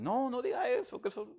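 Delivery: high-pitched imitated plea, well above his voice; pleading protest. Churchgoer begging the preacher to stop, in a high imitated plea.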